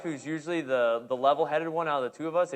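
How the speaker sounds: ordinary talk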